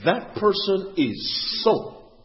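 slow, with "so" held out; emphatic "so". Acted. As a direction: draw out "so" and leave it hanging. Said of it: "Have you ever said that about somebody".

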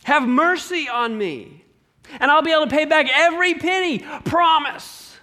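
high, desperate begging, words tumbling at the end; groveling plea. Acted. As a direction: beg for mercy like your life depends on it. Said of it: "He's groveling at the feet of this king".

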